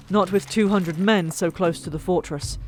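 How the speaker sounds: usual speaking voice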